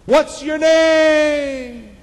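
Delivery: very high, loud, drawn-out holler; screamed out. Acted. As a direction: shout it slowly across the wilderness. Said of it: "He screamed out".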